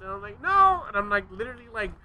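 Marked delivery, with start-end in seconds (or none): 0.4-0.8 high, loud panicked no; a yelp of a refusal; squeaky high no. Retold or imitated. Son grabbing the broom from dad, high yelp. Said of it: "My voice keeps going higher and higher".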